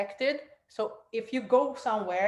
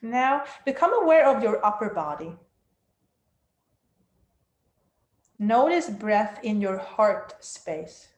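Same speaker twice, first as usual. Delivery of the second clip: guided meditation; 4 dB louder than usual. Acted. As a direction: guide them down into stillness.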